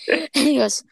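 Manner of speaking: usual speaking voice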